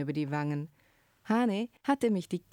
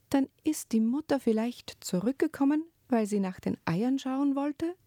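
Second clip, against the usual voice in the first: lightly voiced little girl, higher and anxious; worried girl; 9 semitones higher than usual. A narrator's reading. Scene: Ruru asking the priest about the mother, worried. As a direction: ask it like a worried child. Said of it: "fasste sich besorgt".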